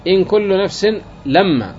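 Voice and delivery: "إن" light, "لما" doubled as "لمّا"; mixed reading. Shown as a demonstration. Teacher reciting the third reading to students, first light, second doubled. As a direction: keep "إن" short and double the mim of "لما". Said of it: "تخفيف الأولى وتشديد الثانية".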